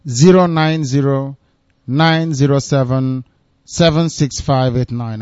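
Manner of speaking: slow, measured digit-by-digit dictation; dictating slowly. Real, not acted. Host giving listeners the number to call, dictating slowly. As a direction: read it out slowly in small groups. Reading out phone number for donors.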